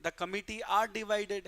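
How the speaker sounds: usual speaking voice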